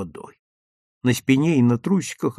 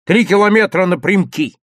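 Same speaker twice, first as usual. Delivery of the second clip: loud, high, impatient urging; heated insistence; cross urging; 8 semitones higher, 7 dB louder than usual. A narrator's reading. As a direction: order a friend along crossly. Angry Lyovka urges his friend on.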